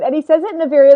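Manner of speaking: normal talking voice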